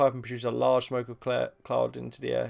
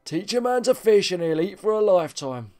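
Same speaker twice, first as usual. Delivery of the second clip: mocking proverb; 6 semitones higher, 4 dB louder than usual. Real, not acted. Mocking the dumb NPC.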